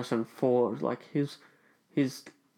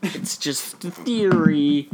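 slow, higher, loud catchphrase; mock show host; 4 semitones higher, 5 dB louder, slower than usual. Signing off a theory segment to listeners, mock-host hype. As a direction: stretch it out to a big loud finish.

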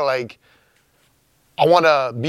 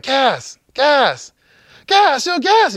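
high, loud retold yell of the name, rising each time; calling out in panic to wake him; frantic calling out; 11 semitones higher, 3 dB louder, much slower than usual. Brother yelling up to him on the roof, high and frantic.

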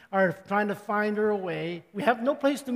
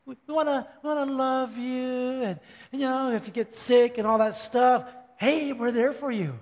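son's sweet talk; 3 semitones higher than usual. Retold or imitated. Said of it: "And he said".

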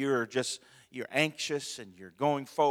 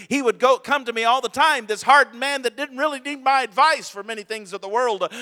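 high, loud preaching climax; full-force preaching; 10 semitones higher, 12 dB louder than usual. Acted. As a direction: drive the story home, loud and high.

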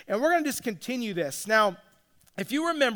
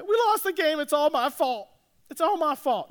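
put-on upset child; 5 semitones higher than usual. Son crying after losing game.

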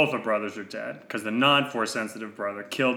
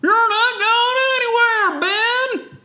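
very high, loud cry; hammed-up shriek to stop him; Jedi challenger; 8 dB louder, slower than usual. Jedi forbids Ben to leave, high loud shriek.

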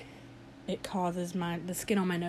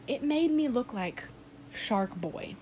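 ordinary talk

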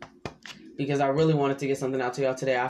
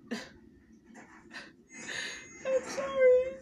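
crying; 3 dB quieter than usual. Real, not acted. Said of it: "I'm not crying".

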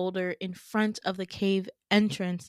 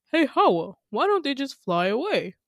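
higher, louder questioning voice; put-on swooping, high questioning voice; imagined heckler; 5 semitones higher, 6 dB louder than usual. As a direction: pipe up like a puzzled listener. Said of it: "You might be thinking".

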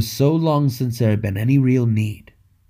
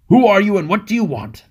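shouting guards; 8 semitones higher, 3 dB louder than usual. A narrator's reading. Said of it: "they shouted".

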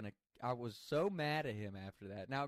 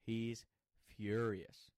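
ordinary delivery

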